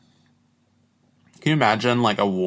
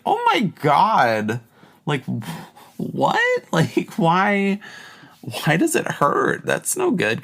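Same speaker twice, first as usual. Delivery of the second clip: shocked reaction; 9 semitones higher, slower than usual. Retold or imitated. Retelling her reaction to a client, shocked and high. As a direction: act out your own horror at his story. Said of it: "And I was like".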